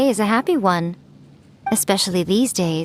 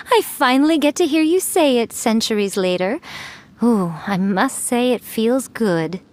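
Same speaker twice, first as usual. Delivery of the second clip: normal talking voice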